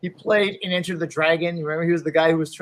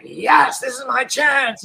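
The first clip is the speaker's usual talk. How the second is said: slow, high, loud cheer to himself; excited; 9 semitones higher, 6 dB louder, slower than usual. Retold or imitated. Actor thrilled at his audition callback, high shout. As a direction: punch the air, finally his big break. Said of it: "So I was like".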